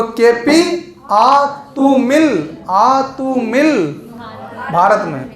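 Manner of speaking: KP आ तू मिल stretched very slowly, each word apart; sing-song, very slow KP आ तू मिल; slow mnemonic. Shown as a demonstration. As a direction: drag out KP आ तू मिल for the class to remember. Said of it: "तो".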